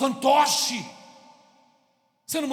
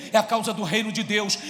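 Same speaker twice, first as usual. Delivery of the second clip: ordinary talk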